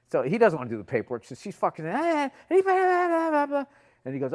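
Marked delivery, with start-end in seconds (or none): 1.9-3.6 very high, loud mock wail; mock near-crying babble. Conductor complaining to the cop, mock tearful babbling. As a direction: babble hysterically as if nearly in tears. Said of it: "going full female mode, like, almost crying".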